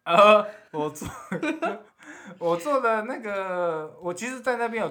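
声音破掉，高而不稳; 嗓子一直破音，慢慢说. Real, not acted. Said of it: "今天一直破音呢". (说话时嗓音破裂)

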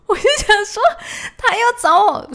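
high, loud laughter of disbelief; disbelieving laugh. Real, not acted. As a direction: laugh at the absurdity. Laughing at wrong change.

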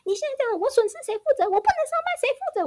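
mock furious rant pitched very high; hammed-up outraged yelling in a high voice; imitating a ranting husband. Husband refusing to calm down, high mock shouting. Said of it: "整个这边大吼大叫".

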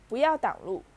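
loud, sharp angry order; forceful, harsh command; stern roar. A narrator's reading. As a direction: roar at someone to get out of the way. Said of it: "荣恩厉声吼着".

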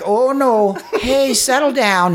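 high, slow soothing mock voice; calm him down like a gentle wife; wife hushing the husband, sweetly mocked.

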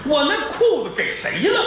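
high, demanding father's question; the father pressing his wife; lightly voiced angry dad; father asking. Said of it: "他爸这儿".

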